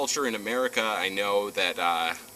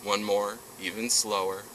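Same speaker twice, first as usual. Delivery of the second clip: guided breathing; 6 dB quieter than usual. Acted.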